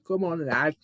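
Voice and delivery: lighter, everyday-volume Scouse; Scouse accent. Pretend. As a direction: urge him on in a little Scouse accent. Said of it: "Do my little Scouse accent".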